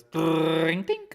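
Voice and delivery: high ringing ding ding like a cash register; bell imitation. Streamer celebrating profits with a mock bell. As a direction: chime it out like a bell.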